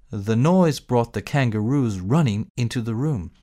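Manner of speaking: usual speaking voice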